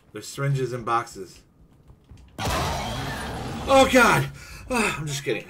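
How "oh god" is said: high, loud put-on cry; hammed-up cry of distress; acted outcry. Gamer just acting for teammates, high mock-distressed outcry. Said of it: "I was just acting".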